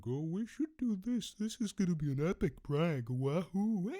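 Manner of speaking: high, loud prankster squeal; hammed-up excited kids. Kids hatching a prank together, mock excited. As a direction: cheer it in a silly high-pitched voice, giggling.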